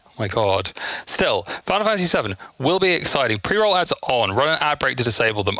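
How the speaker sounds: ordinary talk, nothing marked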